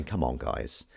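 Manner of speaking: ordinary talk, nothing marked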